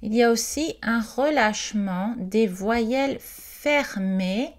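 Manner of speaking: ordinary talk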